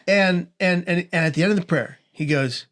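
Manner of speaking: ordinary delivery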